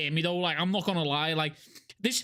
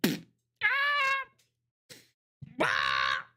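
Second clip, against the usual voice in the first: mock yelp; 13 semitones higher than usual. Mock reaction to insult.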